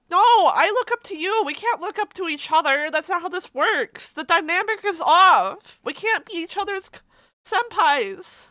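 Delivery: high, loud flustered outburst; flustered protest. Real, not acted. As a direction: protest the compliment, half laughing and half in tears.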